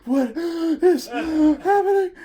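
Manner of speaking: slow high pained cry. Retold or imitated. Clutching his chest on the ground, slow pained wail. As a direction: wail it out in breathless shock.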